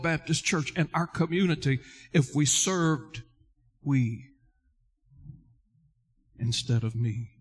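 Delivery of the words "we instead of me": "we", long pause, then "instead of me", low; emphasis on "me". Real, not acted. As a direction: set "we" and "me" apart with a weighty pause. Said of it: "I emphasize that word me".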